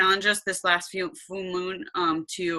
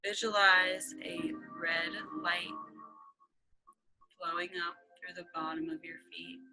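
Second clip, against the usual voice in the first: hushed, calm, half-whispered guidance; guided meditation; 9 dB quieter than usual. Acted. Guided meditation over music.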